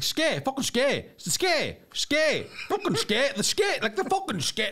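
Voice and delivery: hammed-up Donegal shopkeeper, high and slow. Shopkeeper insists to customer, high mock Donegal accent.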